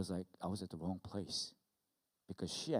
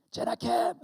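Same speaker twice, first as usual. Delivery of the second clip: loud, high parent's call; imitated scolding shout; full-name summons; 14 semitones higher, 11 dB louder than usual. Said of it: "Usually full name".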